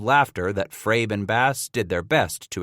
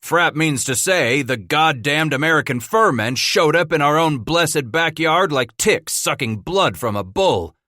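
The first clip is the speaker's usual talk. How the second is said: louder, higher angry outburst; angry exclaiming; 7 semitones higher, 4 dB louder than usual. A narrator's reading. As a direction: spit it out in anger at the rival company. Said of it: "Milt Sublette exclaimed angrily".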